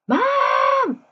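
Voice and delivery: high shrill call of mom from another room; imitated kid yelling. Kid calling mom from another room, high yell. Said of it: "they yell your name".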